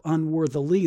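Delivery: "unworthily" said deliberately, its last syllable brought out; "unworthily" pronounced carefully with a marked ending; stress on the ending. Shown as a demonstration. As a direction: lean on the "-ly" of "unworthily". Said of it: "that's why I keep saying it like that".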